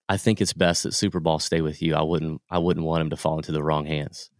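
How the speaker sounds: low, soft, solemn deadpan; mock-solemn entrusting of a treasure; Will Ferrell, dead serious. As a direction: imitate grave sincerity with a straight face. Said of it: "like, dead serious".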